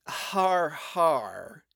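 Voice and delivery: deadpan har har; flat unimpressed fake laugh. A narrator's reading. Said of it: "she replied flatly".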